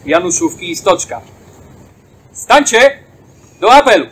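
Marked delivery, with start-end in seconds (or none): 2.3-4.1 loud ceremonial call to stand. Real, not acted. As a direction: call the fallen to roll call. Ceremonial roll call summons.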